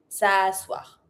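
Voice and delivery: low "S'asseoir" as "sa-swar", no E; silent E. Shown as a demonstration. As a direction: drop the E in "S'asseoir". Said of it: "Don't pronounce the E".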